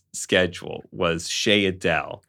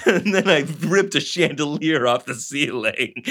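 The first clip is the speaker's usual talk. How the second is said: higher-pitched spoof narrator, laughing; mock narration; 5 semitones higher than usual. Host parodying the narrator's escalating rampage, laughing mock narration. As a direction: improvise absurd narration through giggles.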